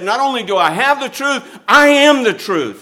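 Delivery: proclaiming Jesus. Acted. Preacher voicing Jesus, raised.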